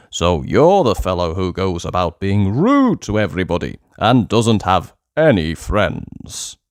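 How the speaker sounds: stern goblin king. Acted. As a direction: weigh each word like a royal judge. King scolds Uppity.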